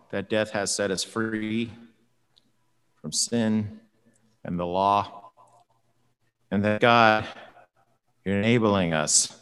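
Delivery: low, soft, unhurried prayer voice; closing prayer. Acted. Preacher leads the congregation in prayer, hushed and low.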